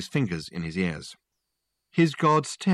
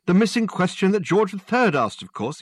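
high, louder exasperated detective; exasperated Dirk; 11 semitones higher, 5 dB louder than usual. Acted. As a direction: talk as if to an idiot.

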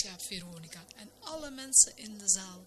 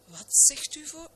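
startled; 9 semitones higher, 4 dB louder than usual. Acted. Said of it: "geschrokken en ontdaan".

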